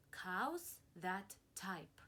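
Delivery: each word stretched out slowly, cows leaned on; slow title read. Acted. Reading the title out to children.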